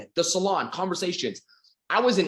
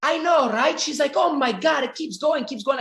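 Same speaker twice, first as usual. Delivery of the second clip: put-on older Italian lady; 11 semitones higher than usual. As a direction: play the agreeing lady in a high voice. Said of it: "She turns to me and she's like".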